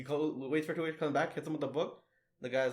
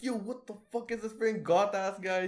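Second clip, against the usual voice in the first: mock freaked-out kid; 6 semitones higher than usual.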